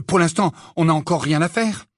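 usual speaking voice

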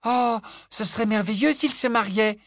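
put-on high dreamy girl voice; delighted little girl. A narrator's reading. Little girl dreaming of a wedding, high delighted voice. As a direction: sigh it out in rapture. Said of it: "murmura Lily avec ravissement".

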